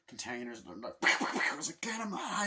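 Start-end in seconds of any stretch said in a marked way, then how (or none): 1.8-2.5 high, eager cheer at the screen; mock fan cheer; excited cheer. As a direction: cheer the fight on, pitched way up. Cheering on a film fight.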